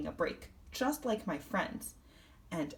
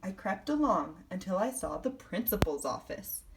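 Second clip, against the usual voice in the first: plain reading voice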